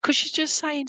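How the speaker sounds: ordinary delivery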